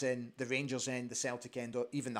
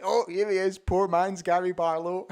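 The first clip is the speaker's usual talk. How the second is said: teasing; 6 semitones higher, 9 dB louder than usual. Retold or imitated. Retold teasing greeting from Neil.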